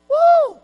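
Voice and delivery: high loud churchgoer whoop; mock whoop. Churchgoer cheering after service, mock whoop. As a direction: let out a hammed-up woo. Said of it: "they'd have said".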